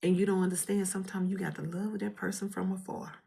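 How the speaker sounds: normal talking voice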